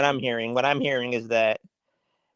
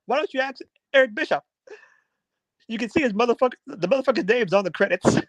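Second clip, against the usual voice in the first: Jim Cornette; 9 semitones higher, 4 dB quieter, faster than usual. Retold or imitated. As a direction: mimic an outraged pundit in a raised pitch. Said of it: "the funniest Jim Cornette rant".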